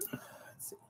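a soft breathy whisper to yourself; whispered aside. Real, not acted. Reader turning the page murmurs to herself, whispered. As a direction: whisper it under the story.

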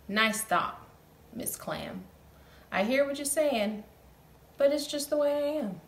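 lightly voiced fish; gloomy fish. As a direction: shrug it off sadly. Fish answers clam.

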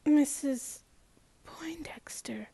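name trailing into a whisper; nervous stammered address. A narrator's reading. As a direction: falter and lose your voice on the name. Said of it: "I stammered, stupidly".

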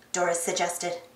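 ordinary delivery, nothing marked

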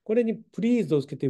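ordinary talk, nothing marked

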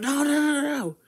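high, loud repeated no; a high frantic plea to stop; high panicked refusal. Acted. Refuses ten more.

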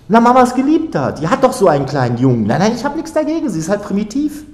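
very high, excited teasing; loud start, fast high-voiced needling; taunting. A narrator's reading. Girl taunts him about mother's lover.

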